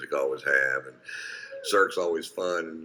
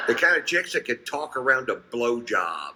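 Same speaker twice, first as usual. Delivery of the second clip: mocking shout; 5 semitones higher than usual. Laughing shout about the chicks.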